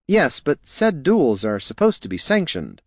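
high-pitched, earnest objector; fussy rule-minded helper. Acted. Helper correcting talk of duels, high fussy objection.

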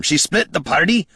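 stunned dwarf outburst; shocked dwarf. A narrator's reading. Gorm character voice.

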